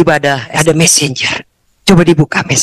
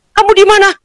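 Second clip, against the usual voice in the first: much higher, excited asking; eager question; 13 semitones higher, faster than usual. Retold or imitated. Mother asking on phone.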